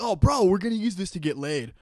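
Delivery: mock dudebro bragging to his buddy; imitating a frat boy who got the prototype. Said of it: "He'd be like".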